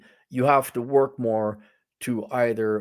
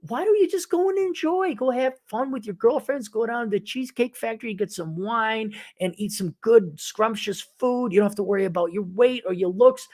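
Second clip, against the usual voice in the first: mock-sweet sarcasm; 7 semitones higher than usual. Real, not acted. Mock-sweet advice to women.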